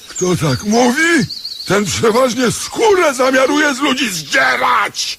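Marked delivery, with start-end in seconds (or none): 1.7-5.2 indignant outcry. Acted. Beggar protests fees.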